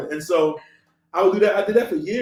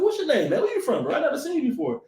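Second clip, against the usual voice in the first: high-pitched quizzing of a stranger; lightly acted, high, inquisitive quote; curious questioning; 7 semitones higher than usual. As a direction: act out sizing up a newcomer.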